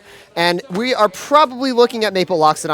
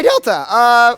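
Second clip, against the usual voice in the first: voice crack; 7 semitones higher, 4 dB louder, slower than usual. Real, not acted. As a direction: voice cracking on a high note. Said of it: "voice crack".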